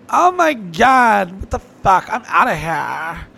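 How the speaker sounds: mock her horror, stretched out; disgusted girl. Girl fleeing a nice guy at the bar, high mocking shriek. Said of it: "they're like".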